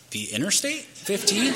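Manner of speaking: high, rising disbelieving echo; retold double-check, pitched right up in disbelief; incredulous. Brother hearing he's walking the interstate, incredulous. Brother can't believe the answer.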